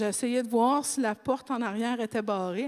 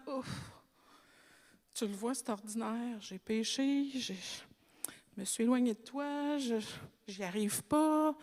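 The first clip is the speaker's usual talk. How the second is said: a tired believer owning up to God; weary confession; 7 dB quieter than usual. Retold or imitated. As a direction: confess your failure quietly, worn out. Confessing weakness before God.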